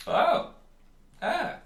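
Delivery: a fussy shopkeeper warning customers off his stock. Acted. Shopkeeper warning the players off the bottle, high and polite.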